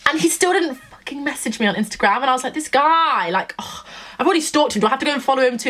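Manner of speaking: high-pitched exasperated complaint. Retold or imitated. Exasperated rant about his not messaging.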